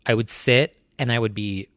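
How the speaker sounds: normal talking voice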